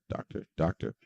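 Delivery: playful whisper. Pretend. Streamer greeting a chat viewer, whispered.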